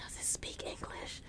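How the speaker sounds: hushed whisper among the passengers; soft, unvoiced aside. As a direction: whisper it behind his back. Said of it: "everybody's like".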